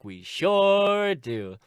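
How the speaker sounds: very slow, loud, high drawn-out answer; mama. Acted. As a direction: stretch it out with mock cheer. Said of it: "And she's like".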